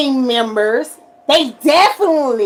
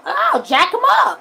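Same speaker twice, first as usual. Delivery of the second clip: a high, slow shouted threat; imitating a cocky sheriff; tough boast; 10 semitones higher, 3 dB louder than usual. Sheriff voiced promising to act.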